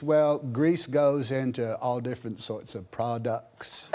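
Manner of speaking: slow Deep South imitation of a boy; unhurried put-on Mississippi accent. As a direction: explain it slowly in a mimicked Mississippi accent. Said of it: "deep in Mississippi".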